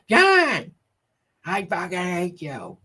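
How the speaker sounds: high, shrill-free mock wife screech at John; mock Karen telling John she hates him. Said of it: "Her voice".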